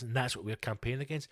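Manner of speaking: ordinary talk, nothing marked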